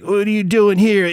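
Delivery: hammed-up guard confronting someone; security guard. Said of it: "the security guard's like".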